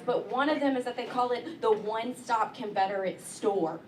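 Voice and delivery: ordinary talk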